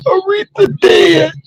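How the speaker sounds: high, slow tearful wail; mock boo-hooing. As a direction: wail the bad news, hammed-up. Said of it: "I mean, I boo-hooed".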